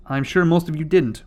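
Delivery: usual speaking voice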